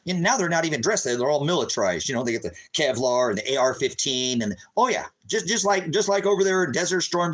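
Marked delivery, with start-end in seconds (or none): none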